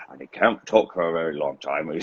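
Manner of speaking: usual speaking voice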